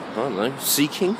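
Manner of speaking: normal talking voice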